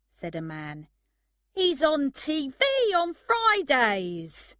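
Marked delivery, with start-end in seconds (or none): 1.5-4.6 high, loud, drawn-out excitement; excited recognition. A narrator's reading. Man tells his companion about the TV star, excited. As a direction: announce the celebrity sighting excitedly.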